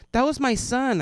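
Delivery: lady voice. Retold or imitated. Lady in line owning up for her son, high. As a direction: announce it like an embarrassed mom. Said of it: "some lady goes".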